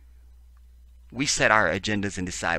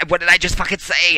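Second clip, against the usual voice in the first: loud, high, exasperated yell; shouting in disbelief; furious shout; 11 semitones higher, 12 dB louder than usual. Real, not acted. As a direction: blow up at the article. Angry outburst reading article.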